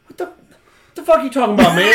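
high, loud, outraged disbelief; incredulous shout. Real, not acted. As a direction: blurt it out as if wrongly accused. Mock outrage at being asked.

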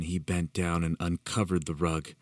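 plain reading voice

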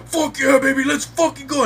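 very high, loud touchdown yell; celebrating yell. Retold or imitated. Fan yelling at a Steelers touchdown, loud and high. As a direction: explode at the touchdown. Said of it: "I'm like".

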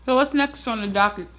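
talking while eating; mouth full. Real, not acted. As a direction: say it around a bite of food. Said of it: "I'm sorry, I'm eating".

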